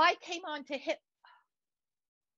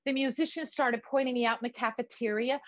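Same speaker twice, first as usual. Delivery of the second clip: normal talking voice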